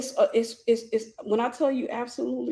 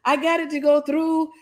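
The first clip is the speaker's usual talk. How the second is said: higher voice mimicking his triumph; retold gleeful boast, pitched up; joyful celebration; 4 semitones higher, 4 dB louder than usual. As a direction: imitate the agent celebrating proudly. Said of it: "his joy and celebration".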